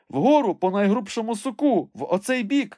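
high-pitched commanding call; calling orders. A narrator's reading. Master directs his climber along the thickest branch, high call. Legrand calls orders up the tree.

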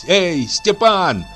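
high, slow call to a deaf man. Acted. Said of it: "позвала она глухого".

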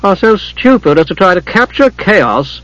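disparaging. A narrator's reading. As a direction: sneer at the dwarf. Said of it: "glanced disparagingly at the dwarf".

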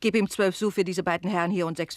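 brisk loud instruction; called order. Acted. Landlady telling the servant what to give, loud. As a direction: bark it across the inn.

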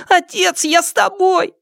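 put-on very high, whiny child voice; whimpering. A narrator's reading. Small son begging to go with father, high whimper. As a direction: whimper high like a small boy left behind. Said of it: "захныкал Сыма Лян".

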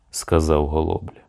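plain reading voice

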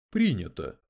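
a touch of cool formality; understated chilly reply; cold. A narrator's reading. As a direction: accept it coolly. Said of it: "холодно отозвался H R C".

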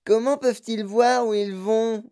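high-pitched cry of alarm; Violette Beauregard crying out. A narrator's reading. Girl in the dark tunnel, crying out. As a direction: call out high, in a girl's voice. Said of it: "cria Violette Beauregard dans l'obscurité".

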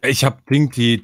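ordinary delivery

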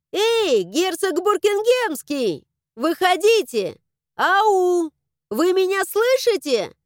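high, drawn-out calling out; insistent, high-pitched shouting; yelling at the top of voice. A narrator's reading. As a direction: holler for someone hidden in the house. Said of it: "начала орать благим матом".

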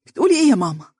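high-pitched, incredulous exclamation; daughter's high, appalled question, falling away; indignant disbelief. A narrator's reading. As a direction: cry out in shocked protest. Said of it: "وهتفت باستنكار".

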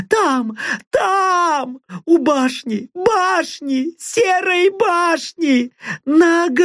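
very high desperate cries; frantic screaming. Acted. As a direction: scream it out in pain. Woman screams under boot. (отчаянный крик)

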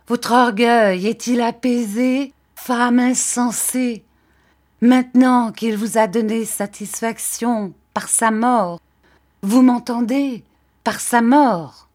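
plus fort et plus aigu, martelé; accusation furieuse. A narrator's reading. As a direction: invective véhémente, chaque coup porté. Rosa accuse la mère du mort. (accusation pleine de fureur)

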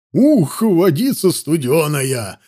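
brisk whoop, then drawn-out words; merry exclamation. A narrator's reading. Soldiers jump into the icy lake, merry exclamations. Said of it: "слышались весёлые возгласы".

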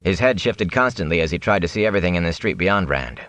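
ordinary delivery

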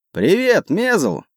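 high-pitched hail across the water; called-out greeting. A narrator's reading. Captain hails his friend on the next ship, calling out. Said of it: "крикнул наш капитан".

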